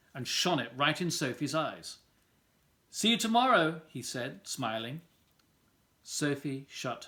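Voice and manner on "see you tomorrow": high and loud teasing goodnight; taunting sing-song. Acted. Wendell taunting Sophie with his flashlight, high and loud. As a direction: tease her with a bright threat.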